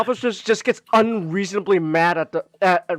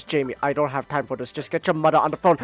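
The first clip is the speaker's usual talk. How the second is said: a busy dad cutting his son off; impatient dad; 6 semitones lower, faster than usual. Retold or imitated. Dad brushes off kid.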